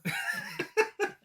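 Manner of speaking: real laugh jumping way up; high laugh. Real, not acted. Host laughing at the queen's comeback, high laugh. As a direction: let a high laugh burst out.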